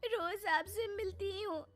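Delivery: put-on sadness. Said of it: "उन्होंने एक आंसू गिराया नहीं कि पिघल गई".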